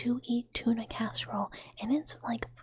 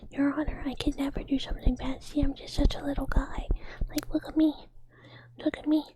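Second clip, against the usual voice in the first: high, whiny whispered plea to a judge; mock-pathetic small voice begging for mercy; pitiful little guy; 5 semitones higher, 3 dB louder than usual. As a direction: play the tiny helpless defendant. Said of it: "I'd be like".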